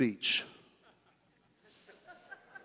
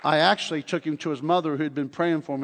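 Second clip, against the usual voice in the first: ordinary delivery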